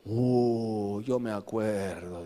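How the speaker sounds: put-on low, wistful old Samson sighing; old Samson remembering. Preacher voicing old Samson, low and wistful. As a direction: sink into a low nostalgic sigh. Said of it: "Sansón dice".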